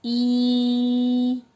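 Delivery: ई held long and steady, teeth pressed; long ई sound. Shown as a demonstration. Teacher models the vowel ई for the class. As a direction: draw out a long ई through the teeth. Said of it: "दांतों को दबा के आवाज निकलती है".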